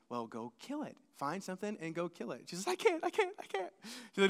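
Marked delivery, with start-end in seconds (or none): 0.1-2.4 low and quiet, offhand quote; much softer, deeper acted reply; understated retold instruction. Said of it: "And Aaron says". 2.7-3.7 high, small panicky protest; quiet, high-pitched mock panic; imitated freak-out. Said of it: "Jaden is freaking out".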